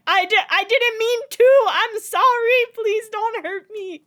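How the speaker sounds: high, loud mock-terrified begging; hammed-up frantic apology, very high-pitched; panicked pleading. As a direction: plead like a cornered man, comically overdone. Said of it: "and Lorcan's like".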